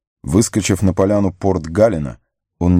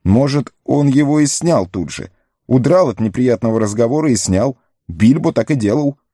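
lightly voiced Merry; 7 semitones higher than usual. Merry's voice, high.

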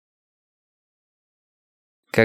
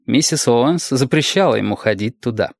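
plain reading voice

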